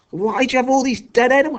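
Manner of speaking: very high, incredulous outburst; appalled demand. A narrator's reading. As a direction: demand an explanation, voice shooting up. Appalled question in acted quarrel.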